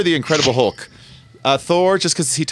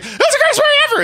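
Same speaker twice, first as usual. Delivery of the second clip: very high, fast squealing rave; mock gushing fan; 3 dB louder, faster than usual. Mocking fans who overpraised the movie, very high squeal. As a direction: gush like an overexcited fan. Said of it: "They're like".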